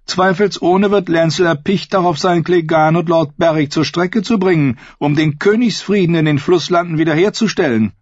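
high, cold, haughty queen; evil queen. Acted. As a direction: sneer down at him as queen. Cersei voiced in reading.

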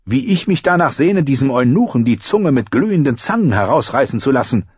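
sarcastic echo with cold menace; a hint of icy queen; mock sweet retort. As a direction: throw his words back at him, venomous. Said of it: "gab Cersei zurück".

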